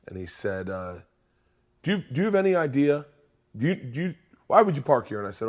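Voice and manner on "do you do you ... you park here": higher, faster mock of an angry cop. Cop scolding a driver in the handicap spot, mock angry. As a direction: rattle it off like an irritated cop. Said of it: "The cop came up very angry".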